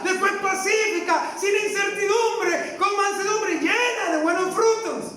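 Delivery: high, ringing pulpit delivery, each phrase hammered; preaching at full force. Acted. Preacher reading scripture to his congregation, full-force preaching. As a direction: proclaim scripture to the whole congregation. Preacher reads James three thirteen.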